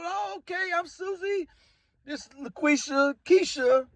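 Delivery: gentle high introduction; friendly woman. Retold or imitated. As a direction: introduce yourself sweetly as a woman at the bar. Woman introducing herself, retold.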